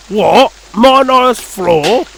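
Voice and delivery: loud, high, slow outraged grumble; grumbling. Acted. Guard protesting his floorboards being taken, grumbling. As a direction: protest over your clean floor. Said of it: "grumbled the guard".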